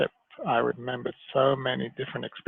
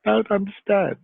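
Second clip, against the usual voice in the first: high, loud mock bewilderment; retold confusion, pitched up; bewildered young self; 8 semitones higher, 6 dB louder than usual. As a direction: act out your younger self, totally lost. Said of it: "I was like".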